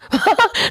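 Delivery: high, helpless laughing fit; cracking up at his own slip; burst of laughter. Real, not acted. (忍唔住爆笑)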